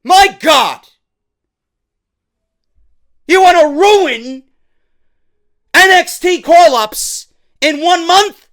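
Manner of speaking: loud, high, drawn-out exasperation; outraged shouting. Real, not acted. Reviewer blasts the ruining of NXT call-ups, outraged. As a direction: hammer it out slowly in outrage.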